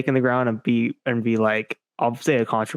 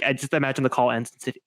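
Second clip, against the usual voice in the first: normal talking voice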